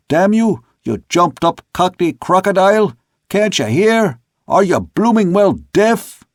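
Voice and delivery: high, loud cursing shout; exasperated officer yelling aloft; angry holler. A narrator's reading. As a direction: bawl abuse up the mast. Second mate gets no answer, curses.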